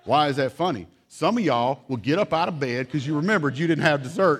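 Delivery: usual speaking voice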